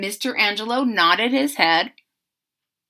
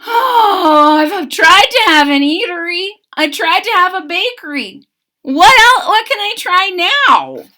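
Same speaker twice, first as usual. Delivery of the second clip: high, loud fretful baker; a touch of despair, hands thrown up; loud high woeful cry; sighing lament; 9 semitones higher, 8 dB louder than usual. A narrator's reading. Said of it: "He sighed".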